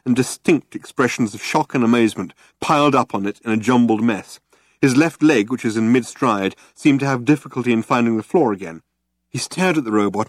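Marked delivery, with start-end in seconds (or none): none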